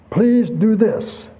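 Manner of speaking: very slow, earnest please; beseeching. Retold or imitated. Preacher voicing Paul's slow gentle plea to the church. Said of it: "That's a beseeching them".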